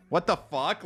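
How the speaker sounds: normal talking voice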